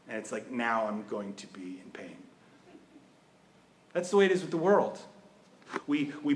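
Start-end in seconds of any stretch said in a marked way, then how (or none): 0.1-2.2 softer, unvoiced rueful aside; whispered aside. Recalling his brother's anger, whispered aside. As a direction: drop to a whisper as if confiding.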